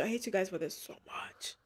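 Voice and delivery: tearful whisper. Real, not acted. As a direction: breathe the "I hate you guys for this so much" with no voice. Repeats the line in a whisper.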